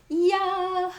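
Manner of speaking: high, sung yeah in a pop diva voice. Retold or imitated. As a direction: riff it like a pop star. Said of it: "That's my [name] impression".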